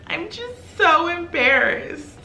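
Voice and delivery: high, drawn-out imitation of a crying friend; mock tearful. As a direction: whimper it slowly, poking fun at her. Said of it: "she's crying in the corner".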